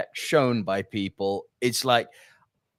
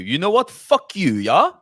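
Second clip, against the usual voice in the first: mock Afrikaans accent; 4 semitones higher, 5 dB louder than usual. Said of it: "a bit of Afrikaans for you there".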